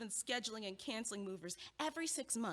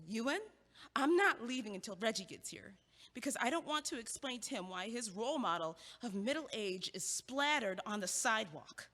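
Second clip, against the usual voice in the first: ordinary delivery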